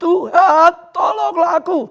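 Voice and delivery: loud, high, drawn-out shout; crying for help. Shown as a demonstration. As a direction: cry out to the Lord like Peter. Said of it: "Ayo saudara teriak". (berteriak minta tolong)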